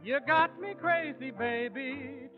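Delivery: ordinary delivery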